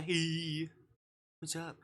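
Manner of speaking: ordinary delivery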